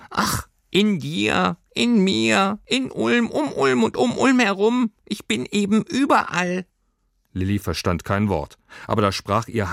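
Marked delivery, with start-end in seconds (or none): 0.1-6.6 high creature voice opening on a sigh; sigh, then pipe it out resignedly; sighing squeaky creature. A narrator's reading. Creature explains to girl it is everywhere, squeaky sigh. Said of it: "Das Pelztier seufzte und sagte".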